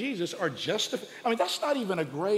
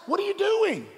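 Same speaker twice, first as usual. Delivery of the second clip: exasperated; 13 semitones higher, 3 dB louder than usual. Retold or imitated. Paul exasperated.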